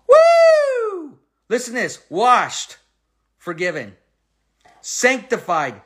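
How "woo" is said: high, loud exultant whoop; joyful whoop. Real, not acted. Preacher whooping with joy after reading the verse. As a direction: let out a delighted cry.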